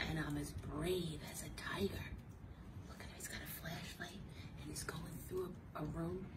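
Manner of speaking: soft tense whisper; spooky brave whisper; suspenseful whisper. Acted. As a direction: whisper as if sneaking in the dark. Tense whisper, boy with flashlight.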